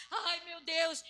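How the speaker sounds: hammed-up woeful cry; mock lament. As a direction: moan it out theatrically. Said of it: "Agar tava lá".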